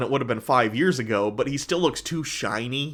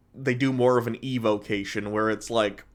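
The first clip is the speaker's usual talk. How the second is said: ordinary talk, nothing marked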